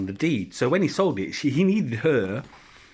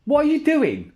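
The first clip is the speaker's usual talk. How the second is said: high, louder telling-off; scolding shout; 12 semitones higher, 4 dB louder than usual. Real, not acted. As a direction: parent breaks off to scold Finley, high and sharp. Scolding child.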